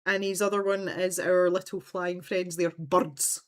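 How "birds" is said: "birds" said the broad Scottish way; Scottish pronunciation. Retold or imitated. Quoting a friend's favourite word, Scottish pronunciation of "birds". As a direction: say "birds" as in imitated Scots. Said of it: "say with a Scottish accent".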